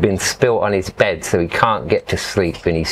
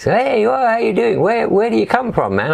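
European backpacker; 12 semitones higher than usual. Retold or imitated. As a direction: hail a stranger like a laid-back traveller. European guys greet him on train.

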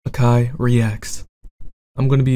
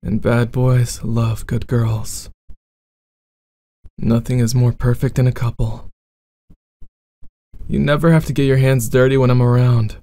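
bad boy roleplay.

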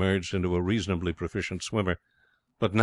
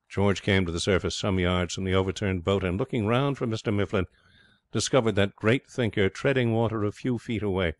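ordinary delivery, nothing marked

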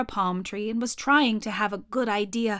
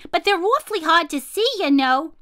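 high, fast, louder monkey voices; little monkeys; 7 semitones higher, 5 dB louder than usual. Acted. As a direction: chatter it quickly like tiny monkeys. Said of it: "said the six little monkeys".

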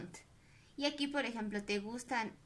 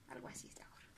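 whisper; 15 dB quieter, much faster than usual. Real, not acted. Drawing the cap, murmuring aside.

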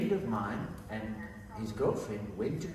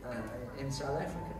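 ordinary delivery

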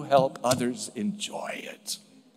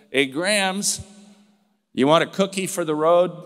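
put-on a touch louder, casual teenage offer; teen's quip; 4 semitones higher, 6 dB louder than usual. Grandson offering dying grandma a cookie, casual cheeky quip. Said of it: "and said".

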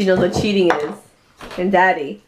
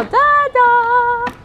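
drawn-out high call; 3 dB louder than usual. Real, not acted. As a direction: stretch it out calling Dada. Calling out to dad.

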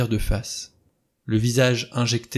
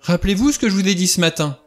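high, loud, rushed insistence; agitated cry; 9 semitones higher, 6 dB louder, faster than usual. A narrator's reading. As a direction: urge them frantically, words tumbling fast. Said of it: "s'écria Vera".

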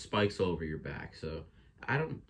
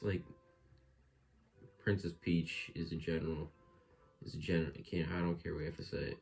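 ordinary talk, nothing marked

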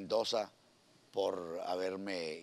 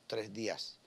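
usual speaking voice

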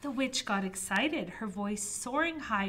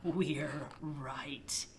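spooky whisper; 5 semitones lower, much slower than usual. Acted. Nasties answer from the dark.

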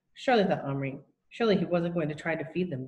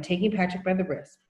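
ordinary delivery

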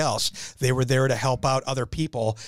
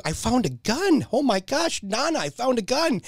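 put-on child voice; 8 semitones higher than usual. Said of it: "He's like".

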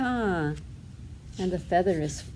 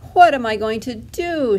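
louder cry of mild despair; 3 semitones higher, 7 dB louder than usual. A narrator's reading. Duckling dazed on the ground, crying out. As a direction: cry it out, a touch helpless. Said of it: "she cried".

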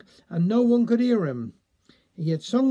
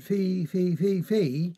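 stammer; slower than usual. A narrator's reading. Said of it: "had what Tom Tiddlywink called a peppermint in his speech".

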